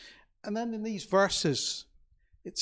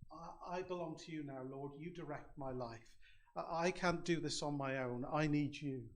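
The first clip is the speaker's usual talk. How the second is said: much softer, hushed surrender to the Lord; humble prayer; 13 dB quieter than usual. Pretend. A believer turning to Christ prays, hushed and humble. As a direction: murmur it like a private prayer of surrender.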